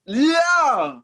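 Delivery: high, loud, drawn-out cry; high-pitched wail of protest; loud outburst. Real, not acted. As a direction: yell it, thrown by the hard choice. Startled by a hard choice.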